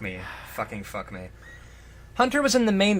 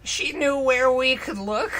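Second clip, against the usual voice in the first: demanding; 9 semitones higher, 4 dB louder than usual. Acted. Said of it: "he demanded".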